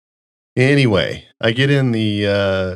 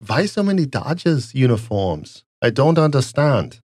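mock German accent; 5 semitones higher than usual. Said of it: "test my German accent".

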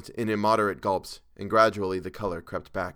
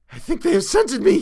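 very high, loud cry of panic; terrified shriek; 10 dB louder than usual. A narrator's reading. Chalmers realizing the creatures have scented him, shrieking in terror. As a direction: shriek it as if you are being hunted. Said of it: "he shrieked".

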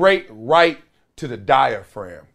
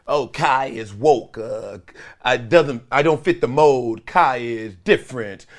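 low, slow mock Kyrie voice; lightly voiced Kyrie, lower and drawn out; imitating Kyrie; 5 semitones lower than usual. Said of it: "he gets into this voice".

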